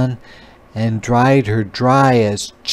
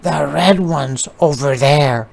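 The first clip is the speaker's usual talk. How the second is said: mama spider; 4 semitones higher than usual. A narrator's reading. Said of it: "said her mama".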